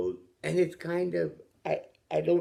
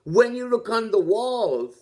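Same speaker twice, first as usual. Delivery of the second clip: excited; 8 semitones higher, 3 dB louder than usual. Real, not acted. Excited claim, high loud.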